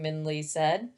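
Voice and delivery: ordinary delivery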